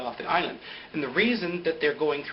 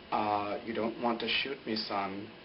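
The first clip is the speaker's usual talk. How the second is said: low, measured retold dialogue; acted character's plea; 6 semitones lower, 7 dB quieter, slower than usual. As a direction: play him low and softer, holding her off. Recapper acts out Ben at gunpoint.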